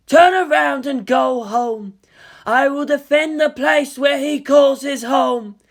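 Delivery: slow, looming, swelling to a shout; an eerie being grieving and threatening; otherworldly girl voice. Acted. Ayanami's ultimatum.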